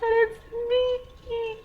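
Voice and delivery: high, whiny mock boo-hoo; a touch of retold sobbing; mock crying. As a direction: squeak it out like a kid crying to mom. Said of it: "crying, boo-hooing".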